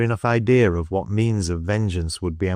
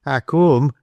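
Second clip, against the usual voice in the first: put-on higher, mildly nervous boy's voice; a touch of fear in a raised pitch; tremulous; 6 semitones higher, 4 dB louder than usual. A narrator's reading. Caught boy explains himself to the woman, tremulous. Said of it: "he said tremulously".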